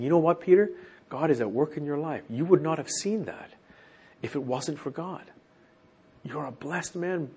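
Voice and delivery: softly sincere, slowing at the end; sincere blessing. Shown as a demonstration. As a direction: tell him quietly that God is at work. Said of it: "He was saying".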